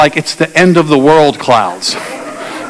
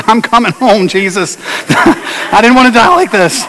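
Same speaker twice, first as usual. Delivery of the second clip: laughing; 4 semitones higher than usual. Retold or imitated. Laughing mock prayer in storm.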